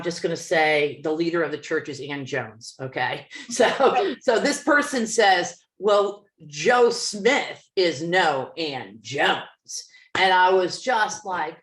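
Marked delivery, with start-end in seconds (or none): 5.8-9.4 slow, drawn-out dismissive line; snide remark. Retold or imitated. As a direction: savour the put-down slowly.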